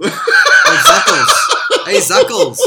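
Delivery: very high, slow, laughing squeal; cackling nickname. Pretend. Mocking Zuckerberg nickname.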